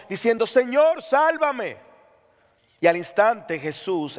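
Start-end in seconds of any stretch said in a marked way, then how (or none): 0.4-1.8 high, urgent plea, lightly acted; crying out for help. Preacher reading Peter's cry as he sinks, crying out for help. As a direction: cry out higher, as if going under. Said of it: "a hundirse, gritó".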